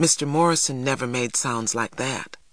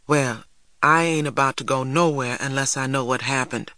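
ordinary delivery

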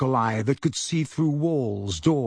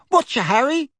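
put-on higher, louder young woman's voice, cheery greeting; young witch; 12 semitones higher, 4 dB louder than usual. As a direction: chirp a cheeky hello.

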